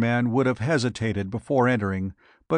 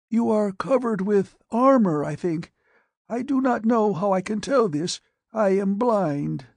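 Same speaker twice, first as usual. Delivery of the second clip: put-on high, frail old man's voice; withered dying patient; 9 semitones higher than usual. Blind radiation patient answers the doctor, high and frail. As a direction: rasp it out like a man aged beyond his years.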